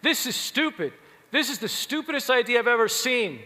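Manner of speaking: loud, high mock yell at the presenter; imitating a scream. Steve screaming at an engineer's idea, loud imitated yell. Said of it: "He would start screaming".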